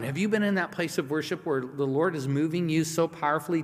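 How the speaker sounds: usual speaking voice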